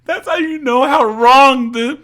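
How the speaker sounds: high, loud ranting; real loud exasperation. Real, not acted. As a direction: shout it in disbelief. Outraged rant after the imitation.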